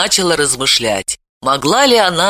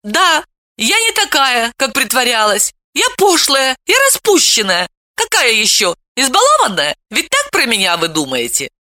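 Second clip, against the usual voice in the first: put-on higher, bold girl's voice, pushing back; defiant young girl; 9 semitones higher than usual. A narrator's reading. Veta's defiant confession.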